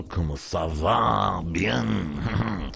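hammed-up French accent, slow on Comment ça va; mock French accent. Host greeting listeners in a mock French accent. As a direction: overdo a French accent for fun. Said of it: "That came out a bit too strong".

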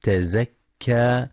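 تزكى with an open, undoubled zay, tazakka; تزكى recited with fatha and no shadda on the zay; light zay. Shown as a demonstration. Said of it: "لفتح الزاي مع تخفيفها".

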